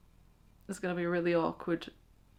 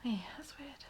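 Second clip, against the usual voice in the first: hushed whisper aside; 4 semitones higher, 12 dB quieter than usual. Real, not acted. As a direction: whisper it to yourself.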